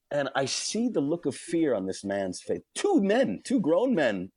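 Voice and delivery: normal talking voice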